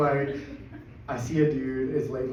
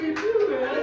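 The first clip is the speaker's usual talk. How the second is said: very high, excited squeal of agreement; hammed-up delight, pitched way up; thrilled agreement; 3 dB louder than usual. Two strangers bonding over Korean food, thrilled.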